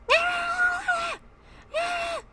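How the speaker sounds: a hammed-up high screech; mock scream. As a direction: imitate her yelling in your face. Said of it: "she's quite literally screaming".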